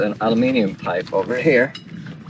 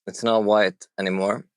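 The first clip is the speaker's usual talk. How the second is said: ordinary delivery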